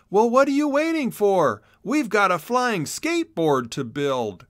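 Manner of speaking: high, loud excited exclamation; rally him to get building; exclaimed. A narrator's reading. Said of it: "and exclaimed".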